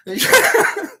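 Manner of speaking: loud, high burst of laughter; laughing. Real, not acted. Laughs after singing.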